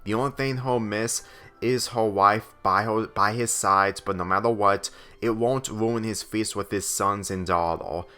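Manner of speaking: ordinary delivery, nothing marked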